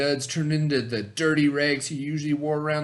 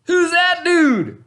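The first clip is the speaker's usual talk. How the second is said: much higher, louder, slow put-on voice; jealous brother; 9 dB louder, slower than usual. Dwayne jealous at the dance, high and slow. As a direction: drawl it out in a silly high voice. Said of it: "said Dwayne".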